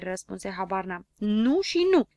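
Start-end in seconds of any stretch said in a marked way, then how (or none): 1.2-2.1 loud, higher, severe "no and no"; stern shout. A narrator's reading. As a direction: shut the boy down hard. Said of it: "strigă cu severitate".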